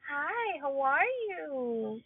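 high, slow, soft sugary phone hello; lightly mock his two-faced nice voice; fake sweet greeting. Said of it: "he'd be like".

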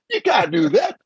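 high mock scolding; playful high-pitched taunt; teasing warrior. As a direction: wag a finger in a high teasing voice. Warriors teasing him about Fiat.

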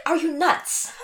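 incredulous, laughing through the words; laughing disbelief. Retold or imitated. Retelling her reply to his text, laughing disbelief. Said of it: "I'm like".

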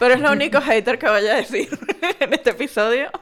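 amused laughing aside. Real, not acted. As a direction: laugh while confessing. Laughing at own hater remark.

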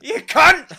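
loud high outburst; mock shout. Bill fed up with accusations, shouting. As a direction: snap it out fed up. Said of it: "Bill just fed up".

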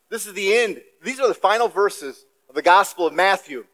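usual speaking voice

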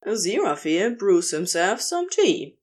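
plain reading voice

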